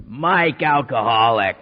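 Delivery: put-on slow, low, loud gruff old man; gruff old-timer. Old-timer Mike introducing himself at meeting, slow gruff voice. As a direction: drawl it like a hard-edged old-timer.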